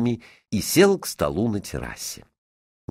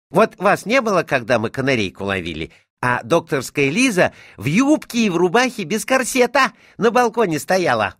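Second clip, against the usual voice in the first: high-pitched tattling schoolboy; cheerful boy; 9 semitones higher, 4 dB louder than usual. A narrator's reading. Boy telling his tutor what he saw, gleefully. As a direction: blurt out gossip with relish.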